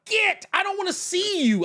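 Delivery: high-pitched comic dismissal; mock shooing. Reader banishing a whiny protagonist, high mock disgust. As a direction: send them off in a squeal.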